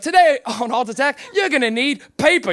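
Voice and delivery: British TV presenter announcing a craft; put-on British show-host patter; British accent. Comic mimics Art Attack host listing materials, British accent. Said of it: "a show with a British guy".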